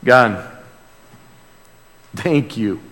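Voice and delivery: normal talking voice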